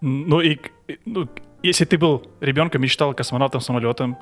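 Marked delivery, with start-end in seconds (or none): none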